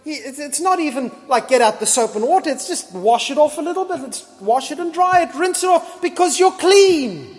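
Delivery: rising delight, insisting you're clean; Jesus's joyful reassurance. Retold or imitated. Jesus telling Christians they're clean, rising and joyful. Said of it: "Jesus looks at us and says".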